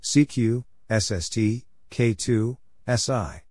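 normal talking voice

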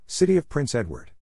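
ordinary delivery

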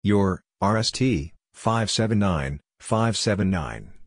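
normal talking voice

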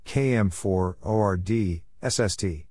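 usual speaking voice

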